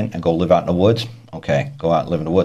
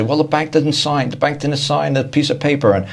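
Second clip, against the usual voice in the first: higher, indignant mocking voice of the folks; mock protest from folks; 7 semitones higher than usual. Debtor telling the speaker the bank never signed, mock indignant and higher. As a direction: whine it back like a clueless customer. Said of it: "they say".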